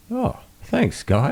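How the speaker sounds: a relieved caller gushing thanks; grateful caller. Retold or imitated. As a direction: caller thanking the help desk guy.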